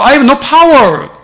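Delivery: drawn-out, very slow "I have no power"; slow confession. Pretend. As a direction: stretch each word, confessing helplessness. Confessing weakness in English. (아주 느리게 늘어뜨린 고백)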